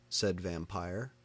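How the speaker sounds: ordinary delivery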